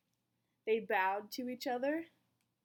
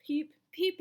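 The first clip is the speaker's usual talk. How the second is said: high, loud tiny chick peeps; the egg peeping from inside its shell; 9 semitones higher, 6 dB louder than usual. A narrator's reading. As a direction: peep like a newborn chick calling out. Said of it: "it said".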